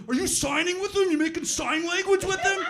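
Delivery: high, loud excited call; a big guy yelling at a gorilla, amazed; excited football player. Retold or imitated. Retired football player calls out to the man at the gorilla glass, high and loud. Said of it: "He's just like".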